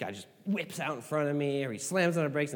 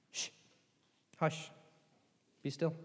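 gentle hush; 10 dB quieter than usual. Retold or imitated. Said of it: "he said to the sea".

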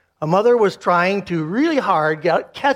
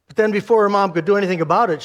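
usual speaking voice

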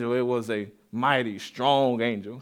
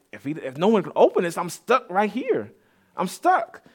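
rising to a high plaintive pitch; acted distress; 5 semitones higher than usual. Preacher voicing John, who wants heaven, in mock distress. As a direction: climb higher into helplessness on each repeat. Preacher voices John's despair at scroll.

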